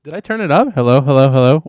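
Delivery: loud, blown-out audio clipping; mic too hot and distorting; clipping distorted mic. Real, not acted. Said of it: "Why are you talking so loud?".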